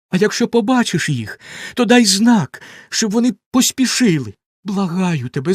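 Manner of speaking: frightened wife begging her sister to watch; anxious pleading. A narrator's reading. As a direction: beg her in a hurry, voice lifted high.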